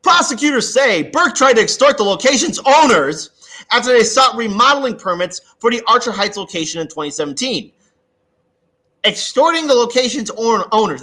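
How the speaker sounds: loud, high reading. Real, not acted. Reading news article aloud.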